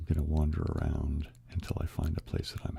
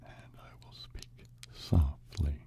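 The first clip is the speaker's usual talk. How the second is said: slow hushed ASMR whisper; 8 dB quieter than usual. Shown as a demonstration. ASMR host whispering to stream viewers, slow and hushed. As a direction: breathe the words close to the mic. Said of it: "I will have a little whisper".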